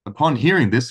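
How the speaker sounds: ordinary delivery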